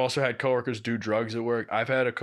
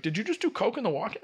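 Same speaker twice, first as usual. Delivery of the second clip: higher and fast, imitating the suspicious boss; boss accusing; 10 semitones higher, faster than usual. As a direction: rattle off the accusation in her voice. She's like.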